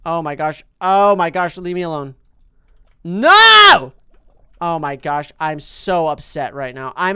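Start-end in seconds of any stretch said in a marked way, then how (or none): 3.0-3.9 high, loud cry of no; sudden loud no; anguished shout. Real, not acted. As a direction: yell as it all goes wrong. Fish attacks her in game.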